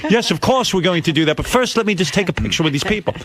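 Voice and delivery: mildly mimicked smooth deflection; a hint of Paul brushing him off. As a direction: imitate a polite celebrity dodge. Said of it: "He went".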